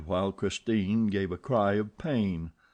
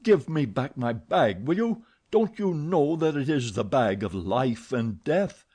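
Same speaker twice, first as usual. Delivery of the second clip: loud, insistent, higher; furious demand; 4 semitones higher, 4 dB louder than usual. Acted. Eric angrily demanding his bag from Christine. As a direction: demand it back, seething.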